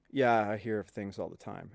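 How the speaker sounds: low, hushed, loaded admission; knowing, ominous understatement. Retold or imitated. Maintenance man hinting at ghosts, low and quiet. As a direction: drop pitch and volume as if hinting at something eerie. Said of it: "the face he made was like".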